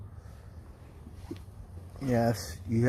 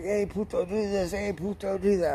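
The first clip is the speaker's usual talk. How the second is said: mocking coworker; 8 semitones higher than usual. Mocking coworker bossing him.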